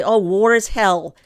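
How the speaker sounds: hammed-up shrug of an excuse; mock excuse. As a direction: parrot the lazy excuse with scorn. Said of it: "you don't get to just say".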